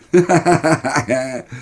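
high burst of acted laughter; boys' mocking laugh. A narrator's reading. Three boys collapse at their prank, laughing. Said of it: "The three of us collapsed laughing".

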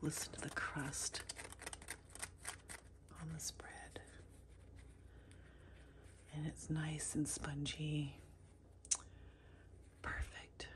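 soft hushed whisper. Real, not acted. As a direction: whisper so no one wakes. Whispering in the Airbnb.

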